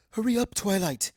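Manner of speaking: high, loud impatient call; calling down the hall. A narrator's reading. Celestia calls down the hall to hurry Twilight, high and loud. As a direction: call her from far down the hall. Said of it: "calls Princess Celestia from down the hall".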